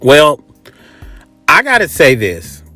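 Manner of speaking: ordinary talk, nothing marked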